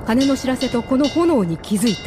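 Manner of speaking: ordinary talk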